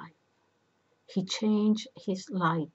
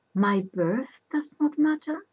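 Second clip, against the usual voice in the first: high, louder disbelief; incredulous question; 7 semitones higher, 5 dB louder than usual. A narrator's reading. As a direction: raise the pitch in indignant astonishment. Oedipus challenges Jocasta in disbelief.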